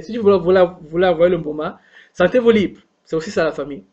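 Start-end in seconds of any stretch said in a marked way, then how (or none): none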